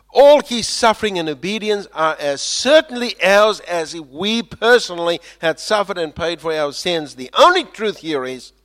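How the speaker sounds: loud, high preaching; preaching at full force. Acted. Preacher proclaiming Christ's work, full force. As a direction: preach it out with fire.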